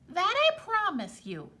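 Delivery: high sly leprechaun voice. Acted. Leprechaun promising Tom, high sly voice.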